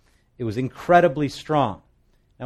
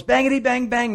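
put-on much higher, louder desperate kid gun noise; yelled gunfire; 11 semitones higher, 4 dB louder than usual. Soldier yelling at the advancing man, desperate mock gunfire. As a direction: yell it as the enemy closes in. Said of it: "and he yells".

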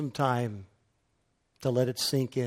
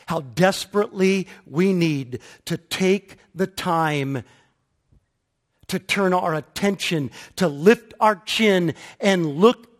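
high, loud, fervent preaching; 6 semitones higher, 10 dB louder than usual. Real, not acted. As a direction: build the sermon to a passionate peak. Preaching builds.

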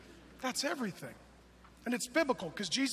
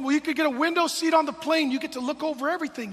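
eager salesman; 6 semitones higher, 9 dB louder, faster than usual. Retold or imitated. Dad selling plane.

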